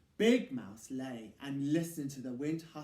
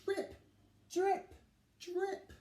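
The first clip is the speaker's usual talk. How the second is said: high, short plinking drips; dripping; slower than usual. A narrator's reading. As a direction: tap each drip out. Dripping sound imitated.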